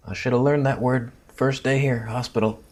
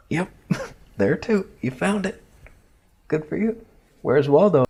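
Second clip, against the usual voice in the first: much higher, laughing through pain, mock-cheerful; laughing sarcasm; 7 semitones higher than usual. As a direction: congratulate him sarcastically while it hurts. Doctor pressing every painful spot.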